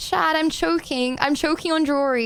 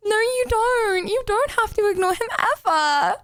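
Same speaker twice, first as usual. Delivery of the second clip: high, pleading and upset; tearful protest; 5 semitones higher than usual. Real, not acted. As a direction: beg through near tears. Protesting mom's advice, teary.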